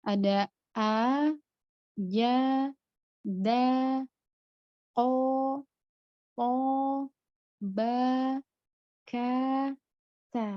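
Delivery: a ja da ko po ba ka ta each said strong, not half-hearted; strong syiddah letters. Shown as a demonstration. Teacher drilling the strong syiddah letters. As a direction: hit each syllable with full force. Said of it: "benar-benar kuat, jadi tidak setengah-setengah".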